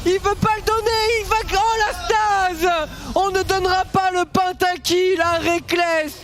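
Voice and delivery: high, excited play-by-play; esports caster. Real, not acted. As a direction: keep the hype up to the finish. Live play-by-play end of game. (commentateur esport survolté)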